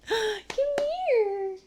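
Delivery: gasping, high cooing call; overwhelmed by cuteness. Real, not acted. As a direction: coo at the dog, melting. Calling the dog, gasping.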